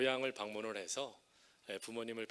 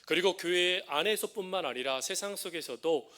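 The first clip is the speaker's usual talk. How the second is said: rising preaching; 3 semitones higher, 5 dB louder than usual. Acted. Pastor exhorting his congregation, rising preaching. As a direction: build the sermon toward its climax.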